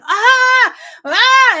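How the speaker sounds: two high, loud screams of fright; frightened screams. Retold or imitated. Woman backing up as the snake eyes her, frightened screams. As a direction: reenact screaming in panic, twice. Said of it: "backing up going".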